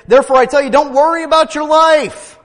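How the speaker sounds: high, loud preacher's proclamation; full-force preaching. Acted.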